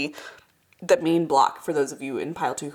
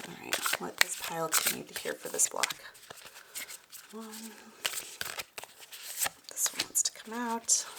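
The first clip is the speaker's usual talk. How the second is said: soft whisper while drawing cards; 11 dB quieter than usual. Real, not acted. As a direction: murmur quietly to the cards. Quiet card pulling.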